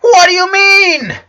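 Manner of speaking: high, loud, slow demand; panicked shouted question; shouting in shock. A narrator's reading. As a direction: yell it, frantic for an answer. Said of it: "he shouted, standing up".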